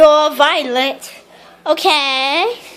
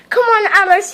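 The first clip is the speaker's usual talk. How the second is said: ordinary talk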